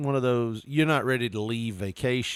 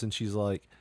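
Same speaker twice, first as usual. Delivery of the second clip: ordinary talk, nothing marked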